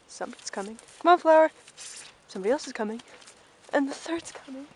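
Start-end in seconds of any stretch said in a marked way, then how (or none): 1.0-1.5 loud, higher call to Flower. Real, not acted. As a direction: call her along. Calling the pony.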